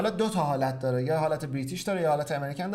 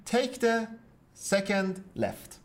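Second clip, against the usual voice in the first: spaced-out demonstration, starting higher; slow model phrase; 5 semitones higher, much slower than usual. Shown as a demonstration. Teacher gives the British way of saying it, slowly spaced. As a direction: stretch each word, pausing before "second left".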